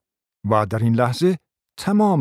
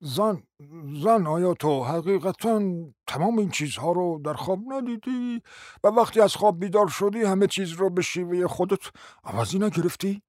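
a hint of a pompous husband, softer; lightly voiced, self-important calm; dignified husband; 6 semitones higher, 4 dB quieter than usual. Husband answering his wife with mild pompous dignity.

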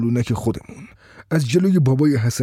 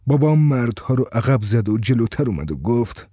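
ordinary delivery, nothing marked

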